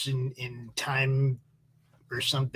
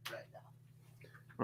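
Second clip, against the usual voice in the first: trailing whisper; 21 dB quieter than usual. Real, not acted.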